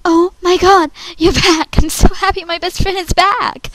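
loud, high-pitched delight; excited. Acted. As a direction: greet a long-lost best friend, thrilled. Audition take, greeting returned friend.

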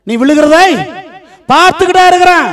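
high, loud preacher's cry; forceful proclamation. Acted. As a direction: proclaim God's promise at full force. Promise repeated as forceful proclamation.

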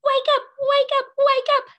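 very high, loud, fast wake-up cry; game whistle sounding to wake the class; shrill whistle-like wake up. Pretend. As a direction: blast it like a whistle. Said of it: "I'm gonna blow my whistle".

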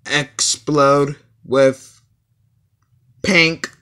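slow dramatic. Acted.

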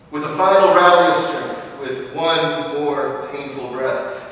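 normal talking voice

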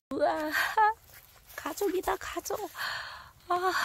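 very high thrilled squeal; high delighted squeal. Real, not acted. Finds cluster of mushrooms.

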